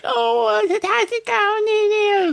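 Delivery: put-on very high cutesy girlfriend voice; baby voice. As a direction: squeak it out cute and babyish. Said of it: "like, kinda do that, like, being cute".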